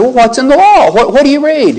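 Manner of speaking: lightly voiced teacher asking back; voicing Jesus. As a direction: turn the lawyer's question back on him. Jesus quoted answering the lawyer.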